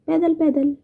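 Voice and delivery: high, proud पैदल पैदल; bright boastful punch on पैदल पैदल; proud emphasis. Real, not acted. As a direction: answer your own question with pride. Proud answer to own question.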